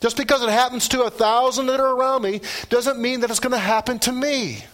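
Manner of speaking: high, loud preaching, building conviction; fervent pulpit delivery; preaching at full force. Acted. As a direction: proclaim it to the congregation.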